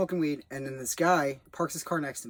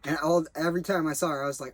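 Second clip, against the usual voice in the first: ordinary delivery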